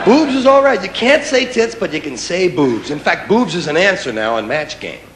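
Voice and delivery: ordinary talk, nothing marked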